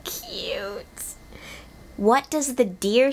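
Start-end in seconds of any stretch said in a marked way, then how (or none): 0.0-0.9 high, breathless whispered squeal. Real, not acted. Overcome by the robot's wolf sound.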